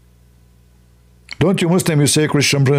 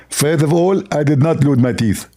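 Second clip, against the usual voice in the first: lisping, slurred impression, pitched up; Zakir Naik. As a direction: talk wetly, as if the mouth is full. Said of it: "How you talk like this?".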